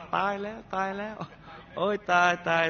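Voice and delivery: high, sing-song mock cry of alarm; neighbor's panicked wail. Neighbour hears the gunshot and cries out, high mock wail. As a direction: wail it like a frightened neighbour, half sung. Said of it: "ร้องลั่นว่า".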